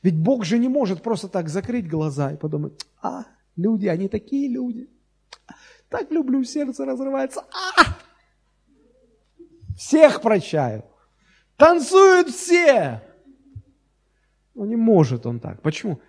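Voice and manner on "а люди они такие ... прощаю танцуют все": gushing, high, then loud; hammed-up doting sentimental voice. Pastor mocks a soft-hearted God.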